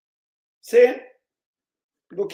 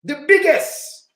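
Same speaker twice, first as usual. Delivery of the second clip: "biggest" stretched slowly on a higher pitch; slow emphatic stress; 5 semitones higher, 5 dB louder, much slower than usual. Real, not acted. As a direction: hit "biggest" high and slow. Repeating the record gun find.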